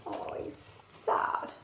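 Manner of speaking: slow soft whisper. Real, not acted. Reader whispering sadly to the child. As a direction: sigh it out tenderly.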